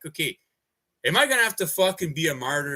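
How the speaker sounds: ordinary delivery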